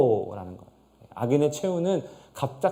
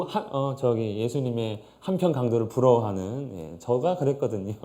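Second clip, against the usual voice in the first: ordinary delivery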